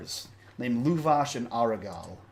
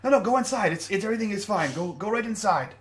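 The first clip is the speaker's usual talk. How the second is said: higher, pushy reassuring guard voice; insistent guard; 8 semitones higher, faster than usual. Acted. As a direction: wave her inside, insisting all is fine. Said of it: "He says".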